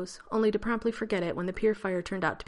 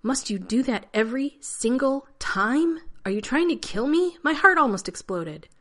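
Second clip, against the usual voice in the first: loud, higher, slowed indignant rant; exasperated scolding; 6 semitones higher, 7 dB louder than usual. Acted. Rodney scolding John for scaring him, loud and indignant. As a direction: berate him for nearly giving you a heart attack.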